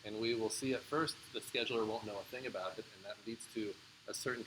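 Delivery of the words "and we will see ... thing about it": normal talking voice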